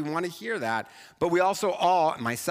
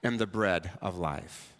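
solemn Jesus; 9 semitones lower, slower than usual. Retold or imitated. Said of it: "And then he says".

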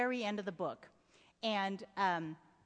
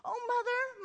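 much higher, eager cry of Mother; a boy flinging himself at his mother, calling Mother high and glad; Mother called out high with a falling finish; bursting out; 11 semitones higher than usual. Acted. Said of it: "he burst out".

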